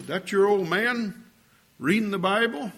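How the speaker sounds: loud, high jeer with a rising question; sarcastic mockery. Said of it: "Sarcastically, he was making fun of me".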